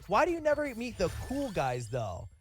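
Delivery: impressed daughter. Retold or imitated. Daughter reacting to her parents' photo, impressed. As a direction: put on an excited fan's high voice, dropping at the end. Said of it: "And she's like".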